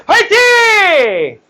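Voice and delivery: very high, long-drawn cheer; rallying shout. Real, not acted. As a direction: belt it out slowly to fire up the class. 참여를 바라며 다시 외침. (높고 길게 외치는 응원 구호)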